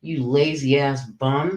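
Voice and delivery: ordinary delivery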